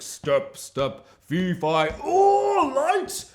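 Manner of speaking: slow plodding steps then a loud high yelp; mock lumbering giant. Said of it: "man's walking forward slowly, like".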